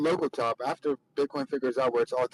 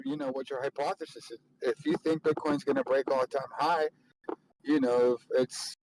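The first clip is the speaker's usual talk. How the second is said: ordinary talk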